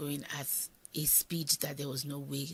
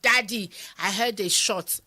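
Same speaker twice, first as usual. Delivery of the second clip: alarmed shout; 4 semitones higher, 10 dB louder than usual. Retold or imitated. Muiwa leaps out alarmed.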